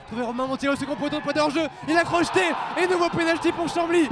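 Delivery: shouted goal call; excited sports commentary. Real, not acted. Penalty call.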